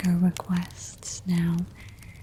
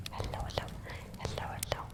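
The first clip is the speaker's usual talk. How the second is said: soft whisper greeting; 15 dB quieter than usual. Acted.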